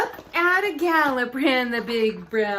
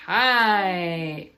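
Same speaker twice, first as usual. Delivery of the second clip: ordinary delivery, nothing marked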